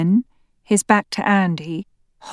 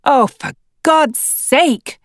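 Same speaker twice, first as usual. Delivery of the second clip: higher, slow exasperated cry; 7 semitones higher, 3 dB louder, slower than usual. A narrator's reading. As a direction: throw up your hands in exasperation. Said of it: "Andy's exasperation is clear".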